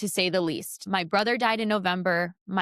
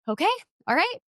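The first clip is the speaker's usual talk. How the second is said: much higher-pitched, rising okay; retold self-rallying; 11 semitones higher, faster than usual. Reporter wiping her face to go cover a game, high-pitched self-rallying. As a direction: lift the pitch sharply, pulling yourself together. Retold composing herself after crying.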